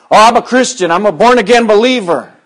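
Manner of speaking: high, loud mock piety; mocking politician. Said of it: "the politicians are saying".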